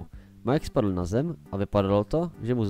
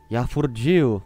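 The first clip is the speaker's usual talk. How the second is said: louder exclamation of disbelief; surprised to be alive; 2 semitones higher, 8 dB louder than usual. Acted. Narrator wakes in the dark, amazed. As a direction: blurt it out in amazement.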